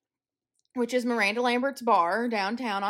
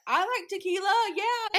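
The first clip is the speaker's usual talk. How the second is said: mock eager agreement; 9 semitones higher than usual. Acting out her agreeable reply.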